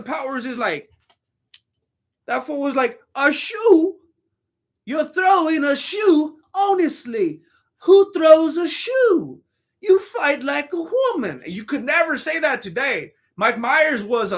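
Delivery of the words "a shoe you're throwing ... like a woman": high, indignant mock-British voice, words stretched out; bad British accent. Retelling the shoe scene from the film, bad British accent, high and indignant. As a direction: Austin Powers outrage in a shaky attempt at British. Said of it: "I can't do a British accent".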